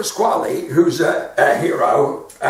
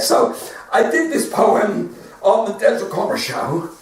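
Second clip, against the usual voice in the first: ordinary talk, nothing marked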